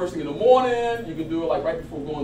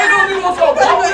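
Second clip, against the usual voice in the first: much higher and louder hype shout; 13 semitones higher, 13 dB louder, faster than usual. Acted. Coach firing up volunteer on stage, shouting. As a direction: fire up the volunteer.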